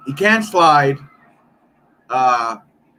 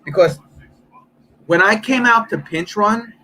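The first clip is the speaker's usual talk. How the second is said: ordinary talk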